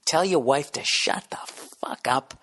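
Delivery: hissed whisper, barely voiced; urgent whispered command to hush; whispered order. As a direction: whisper it so nobody outside hears. Hushed order during the blackout.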